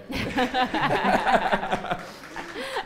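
loud, high burst of laughter; long amused belly laugh. Real, not acted. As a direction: crack up at your own aside. Laughs at own cute obstacle remark.

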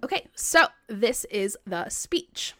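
usual speaking voice

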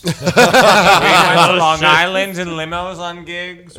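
slow, loud laughing; drawn-out laughing boast. Real, not acted. Laughing boast of past gigs.

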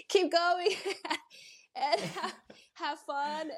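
high, breathy laughs between the words; laughing through it. Retold or imitated. Runner retelling her struggling marathon, laughing through it. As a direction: laugh it off while pushing on. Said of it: "I'm just gonna cry a little bit".

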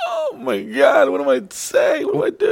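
flail like you're caught out; mock panic. Someone caught by a question, high mock panic. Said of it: "someone's like".

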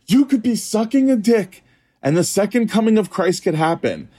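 high, preachy proclamation; emphatic reassurance. Retold or imitated. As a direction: proclaim it like an absolute promise. Acts out counselor's emphatic reassurance.

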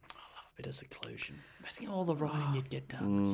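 soft unvoiced whisper. Real, not acted. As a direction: breathe it as a private aside. Whispered aside.

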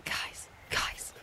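urgent hushed whisper. Pretend. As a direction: whisper so the fox won't hear. Sneaking up on a fox.

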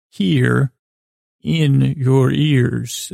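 "here", a pause, then "in your ears" drawn out very slowly; slow, separated "here ... in your ears"; delineated phrasing. Shown as a demonstration. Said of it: "that delineates it a little bit better".

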